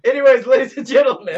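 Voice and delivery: loud, fast arena announcer call; booming ring announcer. Pretend.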